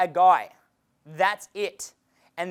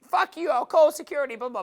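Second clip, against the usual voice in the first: higher, hammed-up yell of an annoyed girl; mock yelling girl; 8 semitones higher, 3 dB louder than usual. Girl threatening to call security, mock yell. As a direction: imitate her yelling at you, half-joking. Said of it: "she can even yell at me".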